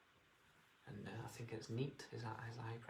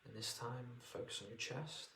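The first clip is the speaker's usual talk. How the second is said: guided meditation; 4 dB quieter than usual. Acted. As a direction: ease them into relaxation.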